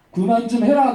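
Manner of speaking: full-voiced order to stop; firm rebuke. [name] finally tells the pestering girl to stop, firm rebuke. [name] fed up, second firmer take.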